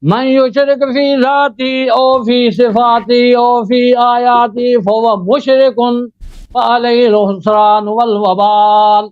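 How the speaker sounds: slow, measured, chanted khutba recitation; formal Arabic sermon opening. Acted. Debater opens his turn with a slow chanted khutba. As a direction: intone the opening praise slowly like a preacher.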